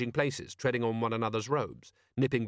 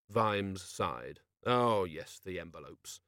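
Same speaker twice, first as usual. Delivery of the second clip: usual speaking voice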